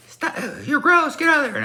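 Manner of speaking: put-on high-pitched squealing kids' protest; mock kid yelling. Students yelling at him to get away, high mock yell. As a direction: imitate grossed-out kids yelling, way up high. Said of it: "they're yelling at me".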